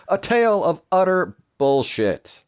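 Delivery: loud, high, slow announcement of the title; mock-epic title. Narrator announcing his story's grand title. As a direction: boom it out like a movie trailer.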